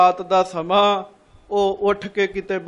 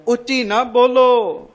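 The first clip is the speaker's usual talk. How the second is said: ਉੱਚੀ ਨਾ ਬੋਲੋ higher, louder and slow; slow urgent plea; 4 semitones higher, 4 dB louder, slower than usual. Retold or imitated. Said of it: "ਉਹ ਕਹਿੰਦਾ ਚੁੱਪ ਰਹੋ ਭਾਈ". (ਹੌਲੀ, ਜ਼ੋਰ ਨਾਲ ਤਾਕੀਦ)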